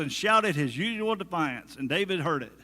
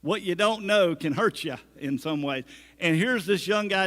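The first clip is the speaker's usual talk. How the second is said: normal talking voice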